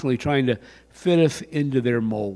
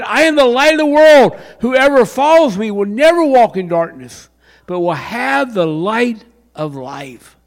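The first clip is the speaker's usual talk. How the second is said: loud proclamation; 9 semitones higher, 8 dB louder than usual. Acted.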